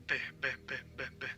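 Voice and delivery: repeated soft "B" for Spanish V; V pronounced B. Shown as a demonstration. Spanish teacher drilling the letter V, said as B. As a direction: drill "B" as the sound of V. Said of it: "is pronounced B".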